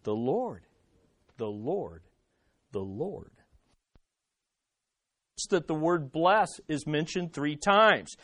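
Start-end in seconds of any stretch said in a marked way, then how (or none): 0.0-3.3 soft, low "the Lord", spaced out with pauses; hushed repetition. Shown as a demonstration. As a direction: let each repeat sink lower and quieter, reverently. Same answer repeated, now hushed.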